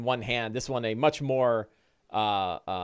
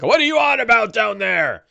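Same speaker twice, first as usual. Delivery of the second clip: loud, raised voice hollering downstairs; irritated orcs upstairs; 8 semitones higher, 7 dB louder than usual. Acted. Game master as orcs upstairs, irritably calling down. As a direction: yell down at whoever is making the racket. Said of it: "you hear two other voices upstairs".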